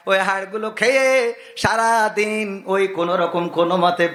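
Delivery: chanting sermon with sudden high-pitched surges; singsong preaching. Acted. Preacher to congregation on animals obeying man, singsong exhortation. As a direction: preach in sustained tones, stretching the phrase endings.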